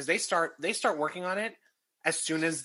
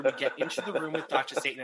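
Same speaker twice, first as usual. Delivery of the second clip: ordinary talk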